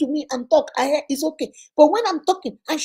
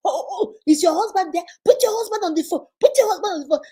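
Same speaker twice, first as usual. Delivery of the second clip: higher, commanding demand down the phone; angry demand; 6 semitones higher than usual. Retold or imitated. Brother demanding the sister's husband come to the phone. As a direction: order the man to take the phone.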